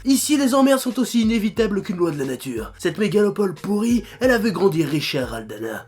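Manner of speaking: deep dramatic trailer narration; movie trailer voice. Acted. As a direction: epic movie trailer read. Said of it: "une voix de bande-annonce de merde".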